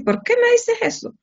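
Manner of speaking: imitating a startled husband; mock startled husband.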